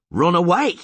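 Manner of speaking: high, loud disbelieving scream. Acted. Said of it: "Geralt screamed".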